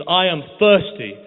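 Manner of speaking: crying out. Acted. Starving stranger entering Medina, crying out slowly. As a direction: call it out at the top of your voice. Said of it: "he says on the top of his voice".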